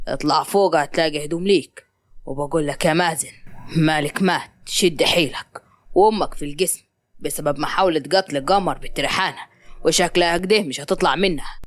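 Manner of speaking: low-pitched, matter-of-fact male character; stern father. Acted. Hisham tells his son of the family's losses, stern. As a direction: break the news in a deep, contained voice.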